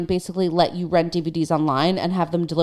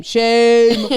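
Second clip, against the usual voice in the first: loud, drawn-out shaming call; higher, louder, mock-solemn chant; mock public shaming; 4 semitones higher, 7 dB louder than usual. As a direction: call out shame like a jeering crowd.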